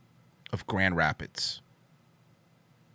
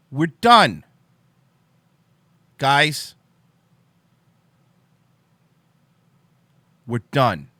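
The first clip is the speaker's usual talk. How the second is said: louder mock-serious declaration; mock dramatic declaration; 3 semitones higher, 10 dB louder, much slower than usual. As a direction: announce the end, hammed up.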